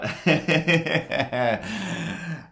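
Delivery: high-pitched chuckling laugh; amused chuckle. Real, not acted. Comedian amused before starting the burn hour, chuckling. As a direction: break into a drawn-out chuckle.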